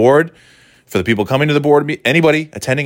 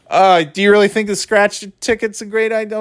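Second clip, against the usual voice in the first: doubtful clerk; 8 semitones higher than usual. Retold or imitated. Retold clerk replying to customers.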